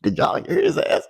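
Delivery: put-on high teasing girl's voice; snickering girl. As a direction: sneer like a mean classmate. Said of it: "a girl in the back starts snickering".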